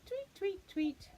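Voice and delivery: high chirpy tweets; tweeting bird. Pretend. Reading to a child, bird tweets high. Bird noise in bedtime book.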